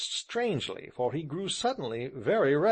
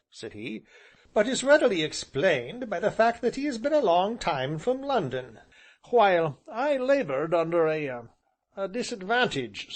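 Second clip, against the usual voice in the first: higher, courtly apology; smooth gentleman; 7 semitones higher, 3 dB louder than usual. Acted. Tall gentleman smoothing things over, courtly. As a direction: explain with a knowing politeness.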